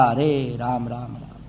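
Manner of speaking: low, soft, sighing lament; grieving sigh. Acted. Minister mourning Rama's exile, sighing softly. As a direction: let the name sink in sorrow.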